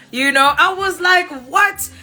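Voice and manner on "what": shocked what. Retold or imitated. Shocked at uncles' answer.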